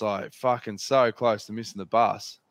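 ordinary delivery

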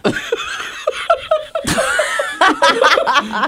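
long high-pitched laugh. Real, not acted. Friend eats dog food.

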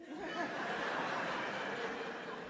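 short airy laugh; breathy laugh. Real, not acted. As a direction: laugh at your own punchline. Laughs after punchline.

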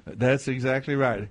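normal talking voice

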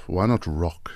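normal talking voice

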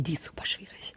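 hushed whisper aside. Acted. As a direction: whisper it like a secret. Whispered joke about Katrin.

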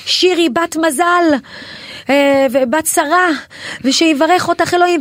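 high, slow, drawn-out blessing; imitated stage blessing. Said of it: "והיא אומרת לי".

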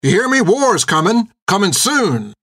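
high, agitated rabble-rousing shout; riled-up rider's shouted warning; shouting at crowd. A narrator's reading. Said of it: "began shouting at the crowd".

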